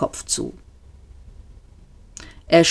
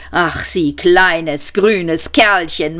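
slow, doting fondness; a slow doting coo; drawn-out gush; slower than usual. Acted. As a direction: savour every word. Zaphod gushes over waiter.

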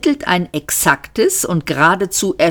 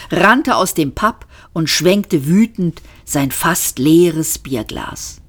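ordinary delivery

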